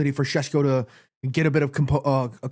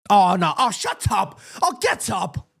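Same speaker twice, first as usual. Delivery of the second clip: high exasperated yell at the player; fed-up outburst; 5 semitones higher, slower than usual. Real, not acted. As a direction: shout at the screen, fed up. Fan yelling at player on ground.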